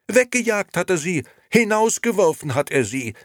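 high, loud indignation, climbing; loud accusing outburst. A narrator's reading. Liliana exposes Nino to Momo, loudly accusing. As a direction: accuse him, rising to a high cry. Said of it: "rief Liliana und klapperte mit den Töpfen".

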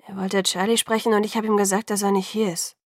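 usual speaking voice

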